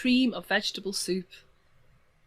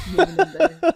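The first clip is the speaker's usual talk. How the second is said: mock goblin outburst; 11 semitones higher, 8 dB louder, slower than usual. Goblin self-mockery.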